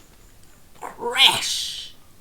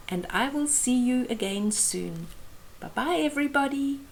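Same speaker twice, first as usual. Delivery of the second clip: ordinary delivery, nothing marked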